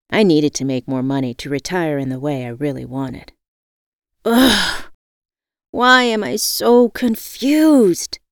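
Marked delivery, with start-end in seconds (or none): 4.2-8.2 loud, high, drawn-out frustrated cry; exasperated yell. A narrator's reading. Narrator yells at herself, confused about her dream job, exasperated yell. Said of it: "I yelled".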